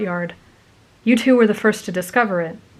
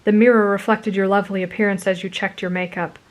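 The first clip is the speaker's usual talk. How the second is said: ordinary delivery, nothing marked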